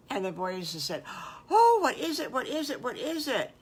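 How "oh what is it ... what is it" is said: put-on high, loud eager child voices; begging to know the surprise; excited kids. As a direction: bounce with excitement. Boys excited about surprise.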